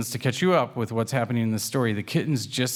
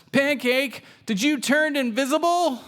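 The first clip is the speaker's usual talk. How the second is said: concerned Waffles. A narrator's reading. Little brother worried his sister vanished, high and alarmed. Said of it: "Waffles gets really concerned".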